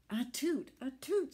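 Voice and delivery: high, loud sneezing toot; squeaky tugboat sneeze. Acted. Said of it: "she sneezed".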